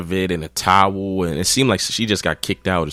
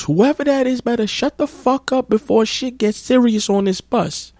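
mock yell. Girl threatens the bus from the front, high mock yell. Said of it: "she yells".

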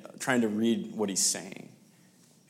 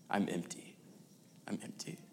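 quiet, then whispered to nothing; fading whisper; 12 dB quieter than usual. Retold or imitated. Preacher echoing an actor's confession, fading to a whisper. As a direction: let it fade into a whisper.

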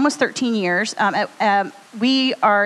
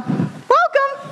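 welcome. Real, not acted. Welcoming newcomers to the show.

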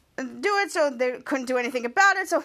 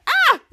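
much higher, louder shriek; startled yelp; 12 semitones higher, 9 dB louder than usual. Retold or imitated. Charlotte shoved out of her seat, a high, loud yelp. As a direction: squeal as you're shoved aside.

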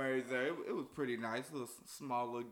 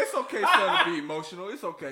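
loud imitation, breaking into laughter; laughing retold reassurance; 3 semitones higher, 12 dB louder than usual. Groom quoting his dad's comfort to friends, laughing and loud. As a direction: crack up while quoting the comfort.

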